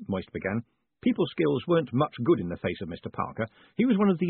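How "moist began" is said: plain reading voice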